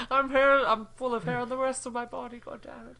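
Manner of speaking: high, loud hammy villain rant; mock villain whine. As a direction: ham up a furious villain. Mocking bald Lex.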